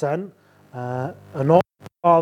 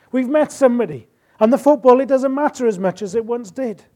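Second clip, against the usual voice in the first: fervent preaching; 6 semitones higher, 5 dB louder than usual. Acted. Preacher builds on love's cost.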